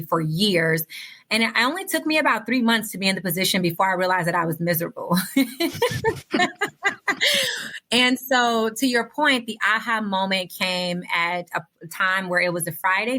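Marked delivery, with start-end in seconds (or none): 5.2-7.8 a long high laugh at her own misery; rueful laugh. Real, not acted. Speaker laughing at realizing she was miserable, rueful laugh.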